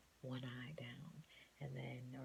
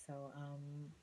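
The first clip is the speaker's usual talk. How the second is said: ordinary talk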